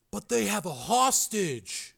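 a touch of dread, drawn out; grim. Acted. Joe reminds the agent about the hostage, grimly. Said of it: "Joe said grimly".

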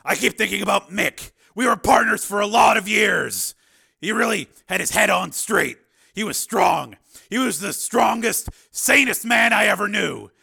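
high, loud, impassioned character voice; troubled Steele. A narrator's reading.

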